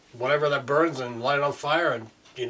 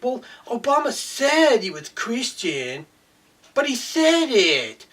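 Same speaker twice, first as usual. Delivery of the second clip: high, slow, dopey mock voice; mocking gullible people; 8 semitones higher, slower than usual. Ranting man mocks gullible believers, high, slow and dopey. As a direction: play them dim and slow, much higher.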